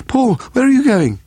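very high female shout. A narrator's reading. Steve to Paul running out, shouting. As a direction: call after him, pitched right up. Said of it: "Steve shouted".

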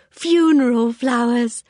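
slowly savoured, unsettling. A narrator's reading. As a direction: linger on it before a giggle. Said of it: "a queer, hysterical little giggle".

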